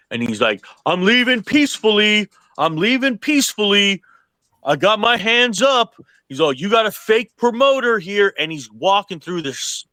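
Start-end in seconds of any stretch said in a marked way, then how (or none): 0.9-5.9 higher-pitched mock yell; half-volume retold holler, voice lifted high; imitated yelling. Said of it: "the fucking show yelling this". 6.5-8.3 higher-pitched mock yell; half-volume retold holler, voice lifted high; imitated yelling. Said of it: "the fucking show yelling this".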